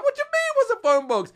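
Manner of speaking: can't believe what he's hearing; high, stunned what-do-you-mean; incredulous. Retold or imitated. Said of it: "I'm like".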